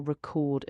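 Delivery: verb "record", said re-CORD; stress on second syllable. Shown as a demonstration. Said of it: "with the stress on the second syllable".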